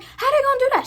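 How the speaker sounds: outraged coworker. Retold or imitated. Coworker indignant about denied vacation days, high outraged voice. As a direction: play her fed up and indignant.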